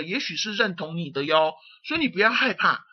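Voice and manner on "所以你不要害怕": ordinary talk, nothing marked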